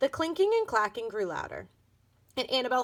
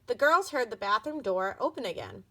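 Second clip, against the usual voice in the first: ordinary delivery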